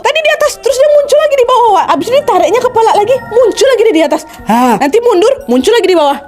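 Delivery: excited. Real, not acted. Excited retelling of peeking.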